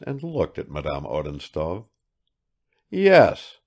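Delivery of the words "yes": loud, high, harsh single word; harsh, forceful retort; exaggerated harshness. A narrator's reading. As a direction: throw it out with overdone harshness. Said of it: "he said with exaggerated harshness".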